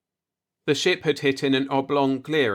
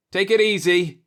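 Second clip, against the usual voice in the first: raised voice calling across; 9 semitones higher, 5 dB louder than usual. A narrator's reading. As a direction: call out a warning. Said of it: "Christ called".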